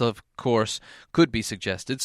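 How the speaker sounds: usual speaking voice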